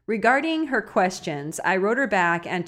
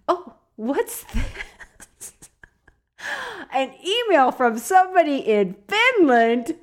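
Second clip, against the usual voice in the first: mock surprise; 7 semitones higher, slower than usual. Pretend surprise at the email.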